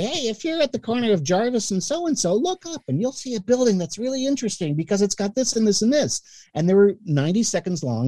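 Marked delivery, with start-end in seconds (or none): 0.0-6.2 high, whiny little radio read; nasal-free but whiny, pitched way up. Retold or imitated. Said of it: "I had my little whiny voice".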